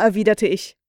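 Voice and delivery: ordinary delivery, nothing marked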